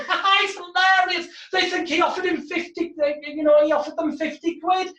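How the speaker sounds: scornful audience taunt; mocking laughter. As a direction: jeer at them like a laughing crowd. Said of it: "the audience were laughing at them going".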